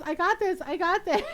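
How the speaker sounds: high, loud retold protest; insistent dad. Dad insisting while lost, retold.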